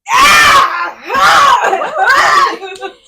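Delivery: loud high shriek of laughter. Real, not acted. Shrieking at gnat.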